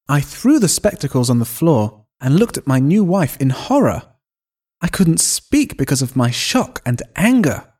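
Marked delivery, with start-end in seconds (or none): none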